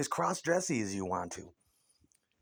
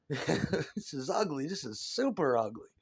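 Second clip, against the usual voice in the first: ordinary delivery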